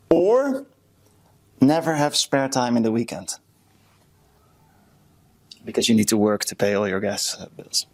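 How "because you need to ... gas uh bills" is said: ordinary talk, nothing marked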